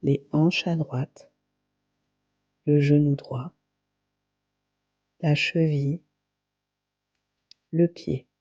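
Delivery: calm, unhurried yoga nidra guidance with long pauses; guided meditation. Acted. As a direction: guide them gently into relaxation, phrase by phrase. (voix posée de méditation guidée, avec de longues pauses)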